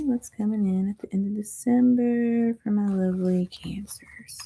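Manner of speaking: soft breathy whisper. Pretend. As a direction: whisper the question to the viewers. Repeats the question in a whisper.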